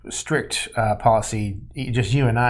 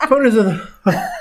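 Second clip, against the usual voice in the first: breaking into laughter mid-phrase; laughing; 12 semitones higher, 5 dB louder than usual. Real, not acted. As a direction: laugh through the words. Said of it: "I didn't take a deep breath".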